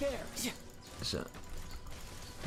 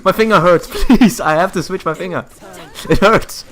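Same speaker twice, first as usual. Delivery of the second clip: laughing complaint; 7 semitones higher, 9 dB louder than usual. Real, not acted. Laughing complaint mid fight.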